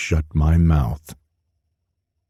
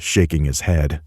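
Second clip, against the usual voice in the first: ordinary delivery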